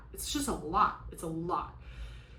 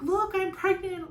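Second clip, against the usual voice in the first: excited announcement; 12 semitones higher, 5 dB louder, slower than usual. Pretend. Imagined happy news to doctor.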